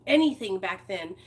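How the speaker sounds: ordinary delivery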